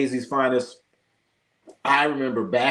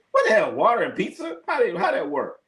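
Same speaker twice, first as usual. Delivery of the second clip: incredulous; 7 semitones higher than usual. Real, not acted. Mocking New York pizza claim.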